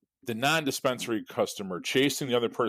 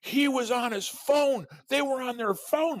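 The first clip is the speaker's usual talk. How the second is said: much higher, slow, outraged whining imitation; mock indignant whine; 14 semitones higher, slower than usual. Furious driver pointing his finger at the kid, slow mock whine. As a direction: whine it like the universe is unfair. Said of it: "they're like".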